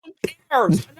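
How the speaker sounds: lightly mocking falsetto, dismissive; high put-on voice, brushing it all aside. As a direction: toss it off in a high, snooty put-on voice. Mocking voice in faction impression.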